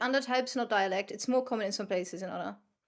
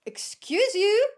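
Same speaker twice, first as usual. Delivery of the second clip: mock offended; 8 semitones higher, 5 dB louder, slower than usual.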